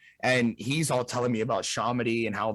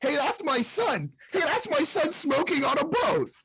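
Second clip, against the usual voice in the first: mock mom voice pitched way up; imitating mom; 13 semitones higher than usual. Son imitates mom catching him on TV, high mock mom voice. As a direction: play his mom spotting him on TV, high and delighted. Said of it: "and going".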